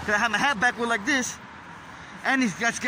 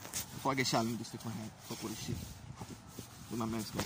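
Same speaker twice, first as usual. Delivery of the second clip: mumbled aside; 10 semitones lower, 14 dB quieter than usual. Real, not acted.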